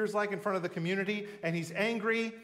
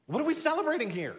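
indignant; 9 semitones higher, 4 dB louder than usual. Acted. Mocking the older brother's complaint.

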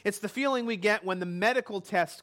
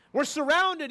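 panicked cry; 6 semitones higher, 3 dB louder than usual. Acted. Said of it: "he's crying out".

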